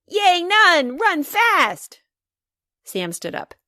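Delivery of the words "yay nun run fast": very high, loud cheer; excited shriek. Acted. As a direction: squeal it like a little girl jumping on a rock. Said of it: "shrieked Bridget".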